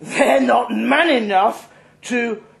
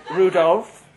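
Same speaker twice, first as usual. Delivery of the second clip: laughing. Acted. Drunk passenger teasing the rider, giggling. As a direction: laugh through the name, teasing.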